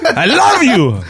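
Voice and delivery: affectionate mock yell, way up high; high comic holler of love; playing a dad who texts in capitals; all-caps dad. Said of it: "it's always in all caps".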